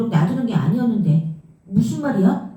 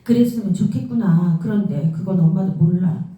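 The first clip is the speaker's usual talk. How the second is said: usual speaking voice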